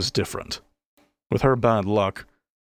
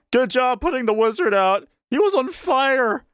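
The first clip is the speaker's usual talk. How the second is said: mocking heckler; 4 dB louder than usual. A narrator's reading. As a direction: overdo the fake praise to needle her. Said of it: "the heckler continued".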